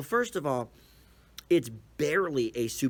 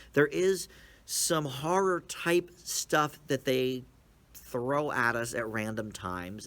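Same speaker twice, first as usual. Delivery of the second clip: ordinary delivery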